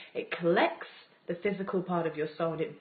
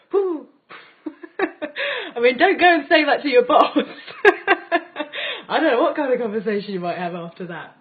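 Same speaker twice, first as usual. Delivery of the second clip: laughing; 6 semitones higher, 9 dB louder than usual. Real, not acted. Laughs at her own joke.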